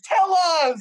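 high, loud demand; screaming. Real, not acted. Co-host pushing host to reveal a secret, screaming. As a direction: yell it out to force an answer. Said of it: "Why are you screaming?".

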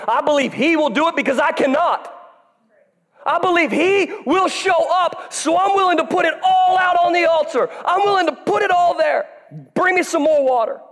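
shouting preacher building to a peak; full-force preaching. Real, not acted. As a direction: preach it out with fire.

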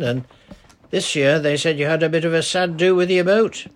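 put-on easy, friendly old dialect voice; lightly voiced old charcoal burner greeting a girl. Old man chats while climbing.

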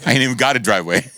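fast, laughing self-mocking aside. Real, not acted. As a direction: laugh through the confession. Laughing at own joke.

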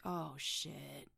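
hushed, dawning dread; a low "Oh, shit" to yourself; quiet dread. Retold or imitated. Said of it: "you're like".

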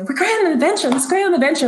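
put-on high, thrilled child's voice; excited little girl. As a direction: squeal it like a delighted little girl. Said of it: "she's so excited".

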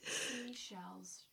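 soft breathy whisper. Shown as a demonstration. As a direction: whisper the word. Whispering the tongue twister.

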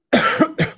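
sharp cough; coughing fit. Real, not acted. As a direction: cough hard.

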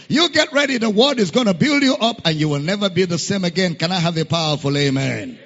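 rally the congregation into a roar of praise; full-force preaching, hyping up the crowd; rousing preacher. Real, not acted.

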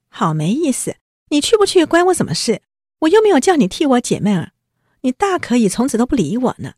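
越说越高越响的赌气话; 气冲冲地顶回去, 声音拔高; 赌气发火. Retold or imitated. 黛玉赌气争吵. (尖声赌气地顶撞)

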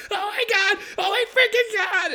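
very high, giddy mock squeal; so excited. Friends overjoyed at the clubhouse, hammed-up high squeal. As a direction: squeal like little girls jumping around. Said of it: "And they're so excited".

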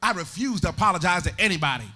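loud, sing-song preaching cadence; full-force preaching. Acted.

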